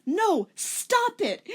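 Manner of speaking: put-on slow, high and loud girl's cry; hammed-up desperate refusal; high mock shriek. Rachel refusing the pills, high and loud.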